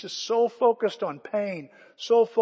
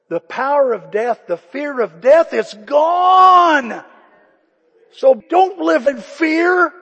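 rising to a loud, slow preaching shout; full-force preaching; 7 semitones higher, 12 dB louder, slower than usual. Acted. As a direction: proclaim it, building to a roar over the congregation. Preacher proclaiming victory over death.

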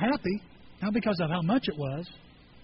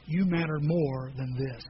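tender prayer; 5 semitones lower, slower than usual. Retold or imitated. Pastor voicing a tender vow to God. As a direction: lower and slow.

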